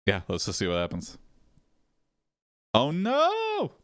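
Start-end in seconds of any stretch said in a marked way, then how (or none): 2.7-3.7 very high, rising "oh no"; dismayed cry. Real, not acted. Player dreading what the game does next, high dismay. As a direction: let it soar up in alarm. Reacting to the game's turn.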